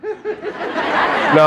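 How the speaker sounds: ordinary talk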